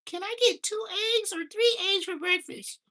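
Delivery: put-on squeaky little kid asking for breakfast; high kid voice. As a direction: pitch way up like a small child.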